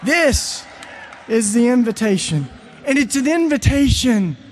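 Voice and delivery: loud, high, driving sermon voice; a preacher building to a climax; fervent preaching. Acted. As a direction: preach it to the back row. Preaches at rising force.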